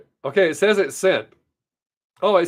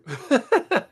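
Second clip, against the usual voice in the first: high laugh; 9 semitones higher than usual. Real, not acted. Laughs at something weird.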